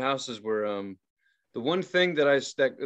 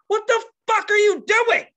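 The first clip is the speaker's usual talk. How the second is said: high, loud mock screech; outraged woman; 8 dB louder than usual. Scared woman to the ghost, screeched mock outrage. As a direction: imitate an irate customer shrieking.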